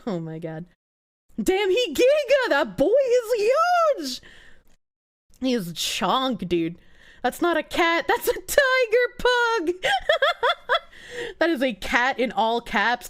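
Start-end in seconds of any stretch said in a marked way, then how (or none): 1.4-4.2 excited marvel. Real, not acted. Streamer marvels at huge cat. 8.1-9.7 delighted squeal. Real, not acted. Joke about giant cat.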